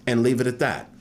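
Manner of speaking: ordinary talk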